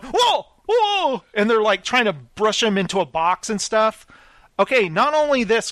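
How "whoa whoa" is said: high-pitched imitation of startled workers; mock alarm. Workers going around the spider house, mock alarm. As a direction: yelp it high like startled men. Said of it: "they're all going".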